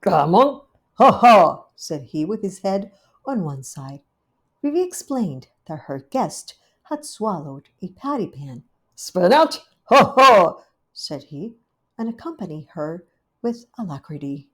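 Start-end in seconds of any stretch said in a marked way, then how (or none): 0.0-1.6 loud cawing magpie call with a laugh; loud bird voice cackling; magpie doctor. Acted. 9.0-10.6 loud cawing magpie call with a laugh; loud bird voice cackling; magpie doctor. Acted.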